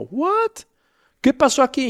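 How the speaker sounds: high, loud baffled outburst. Retold or imitated. Father baffled when his son runs off crying, high and loud. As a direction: throw the words up in high, loud confusion.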